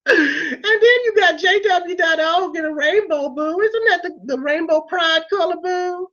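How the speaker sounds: high, fast, sassy drag diva; sassy drag queen. Acted. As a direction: camp scolding queen, honey boo. Drag persona scolding toilet cleaner.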